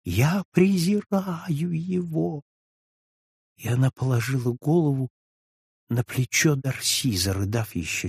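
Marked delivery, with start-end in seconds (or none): 0.0-2.4 very slow, high cry of contempt; Julie, sobbing on Darcy, cries out her contempt for her husband; beside herself. A narrator's reading. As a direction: drag out the contempt, voice lifted high. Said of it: "вскричала Жюли вне себя".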